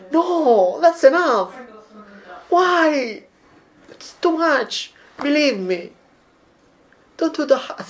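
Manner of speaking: pleading high voice, begging her to stop; high-pitched protest. Acted. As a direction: squeal no like a horrified Italian. Italian protesting too much garlic.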